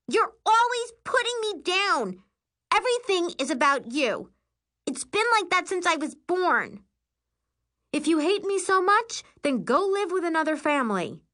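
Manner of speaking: high, heated torrent of grievances; furious tirade. A narrator's reading. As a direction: let years of resentment burst out. Screaming sister's tirade continues.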